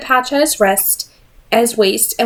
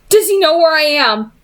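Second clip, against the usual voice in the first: demanding; 8 semitones higher than usual. A narrator's reading. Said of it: "he demanded".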